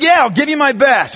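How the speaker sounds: high, forceful answer back; emphatic commitment. Retold or imitated. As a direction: commit loudly, as if pushed to it. Player finally answers with force.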